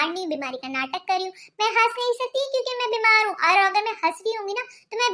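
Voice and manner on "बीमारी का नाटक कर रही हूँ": mocking refrain. [name] mocks her husband's suspicion, low sarcastic drop. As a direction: drop much lower and softer, sarcastic.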